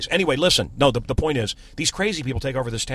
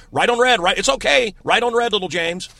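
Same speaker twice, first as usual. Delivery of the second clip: mocking reassurance; 8 semitones higher, 6 dB louder than usual.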